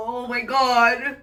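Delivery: tearful exasperation. Real, not acted. Exasperated while crying.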